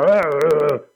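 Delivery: short loud growl of annoyance; frustrated growl. Retold or imitated. At the shoeshine boy who missed a spot, irritated growl. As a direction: snap out an irritated grunt at the boy. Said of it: "and you go".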